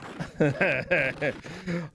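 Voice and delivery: excited chuckle; short run of giggly laughter; laughing. Real, not acted. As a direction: laugh at what you just spotted coming next. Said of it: "I'm laughing because I saw the next game".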